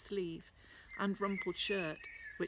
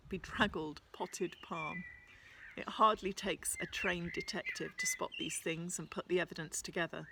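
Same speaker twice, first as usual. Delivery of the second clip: plain reading voice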